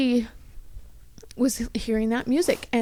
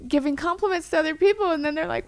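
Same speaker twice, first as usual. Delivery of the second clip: ordinary talk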